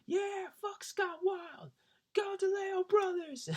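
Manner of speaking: very high, slow mock fan shout; fan yelling. A fan cheers the [name] brothers, high slow mock shout. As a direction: jeer it up high like a rowdy fan. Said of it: "one fan was like".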